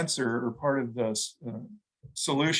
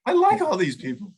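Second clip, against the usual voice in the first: high, louder burst of acted delight; delighted reaction; 6 semitones higher, 5 dB louder than usual. Man reacting to a list of famous threes, acted delight. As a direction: jump way up in pitch, pleasantly surprised.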